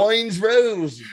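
a hint of a posh British royal decree; British accent. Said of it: "You have to say that in a British accent".

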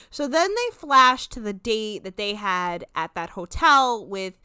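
normal talking voice

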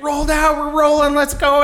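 high, loud urgent shout; excited order to roll out; shouted call-out. Retold or imitated. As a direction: yell to wake the room. Sudden night call-out.

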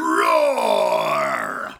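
very low growling roar; deep lion roar. Acted. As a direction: roar like a big cat.

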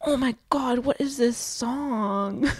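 mock awe. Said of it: "But they're like".

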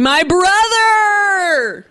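very drawn-out, high excited cry; mock excitement. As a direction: stretch it into a huge high-pitched reveal. Mock announcement of the caller Jonah.

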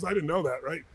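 ordinary delivery

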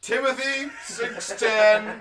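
slow, high preaching vicar voice; kindly vicar sermon. Shown as a demonstration. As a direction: intone it like a vicar. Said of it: "Do I, do I vicar voice?".

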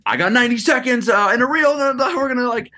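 high-pitched hyped-up reel voice; all excited. Retold or imitated. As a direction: imitate your own frantic on-camera hype. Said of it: "get all excited".

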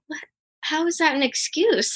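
mildly incredulous comeback, replayed; retold disbelief. Caller retells answering family who say she makes excuses, mildly incredulous.

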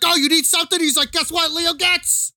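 Joe Pesci impression.

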